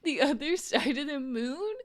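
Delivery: hammed-up heartbreak with a laugh in it; mock tearful lament. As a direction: lament it like you could cry, half laughing. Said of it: "that made me wanna cry".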